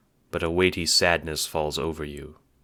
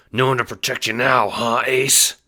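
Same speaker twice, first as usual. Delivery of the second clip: higher, louder gloating taunt; taunting bookie; 7 semitones higher, 5 dB louder than usual. Acted. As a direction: gloat over cornered prey.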